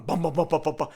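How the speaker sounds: loud, rapid thudding bums; imitated running footsteps. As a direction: pound out the running feet vocally. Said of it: "Somebody was running in the room".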